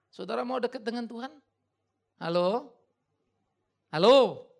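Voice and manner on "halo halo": loud call, first rising then falling; calling out to the congregation. Real, not acted. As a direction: wake the room up with a loud hello. (memanggil jemaat dengan lantang)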